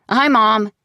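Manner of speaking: high, loud call over shoulder. Acted. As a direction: toss a greeting upstairs.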